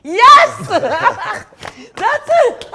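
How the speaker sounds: shrieking with delighted laughter; laughing. Real, not acted. Host cheering the guest's Valley Girl try, laughing.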